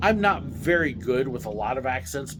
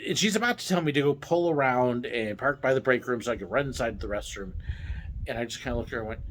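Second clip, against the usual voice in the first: ordinary delivery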